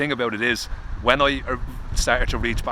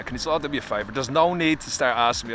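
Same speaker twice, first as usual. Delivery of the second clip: ordinary talk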